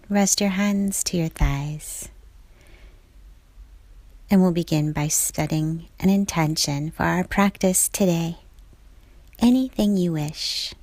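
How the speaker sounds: ordinary delivery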